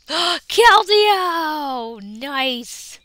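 loud, high cry of delight; joyful shout of the name; excited shout. Real, not acted. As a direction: call the card out thrilled. Pulls Keldeo after a gasp.

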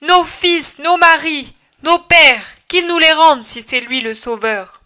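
high, loud collective demand; crowd crying out. Acted. Villagers crying out for their sons, high loud demand. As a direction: shout it as the whole angry crowd. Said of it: "Ils crient tous".

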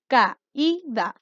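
CAÍDA split slowly into ca, í, da; teacher sounding out CAÍDA as ca-í-da; syllable by syllable. Shown as a demonstration. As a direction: break CAÍDA into three separate syllables. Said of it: "Tenemos tres sílabas".

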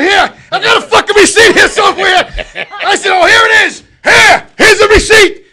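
loud, high, flustered yell; frantic shout. Retold or imitated. To the clerk, frantic high yell. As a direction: push the receipt at him, frantic. Frantic handing over receipt.

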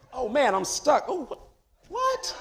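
high, laughing mock-ram voice; a comic stuck ram, playfully; voicing the ram. Preacher voicing the stuck ram, high and laughing. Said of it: "he was just walking all".

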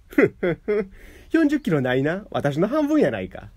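gloating. A narrator's reading. Wife lifts man, gloating.

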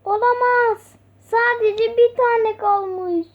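high, loud dismayed cry. Acted. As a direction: gasp out the bad news. Finds only one left.

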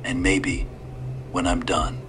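lightly voiced old man, calm and sly; a hint of a courteous old stranger. A narrator's reading.

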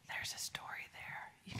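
quick hushed aside with a gasp; whisper. Real, not acted. To a child who has a story, a quick whisper.

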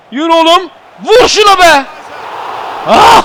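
high, loud yell ending in a cry; frantic shouting. Real, not acted. Gamer urging his player to shoot, frantic shouting.

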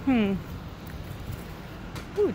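usual speaking voice

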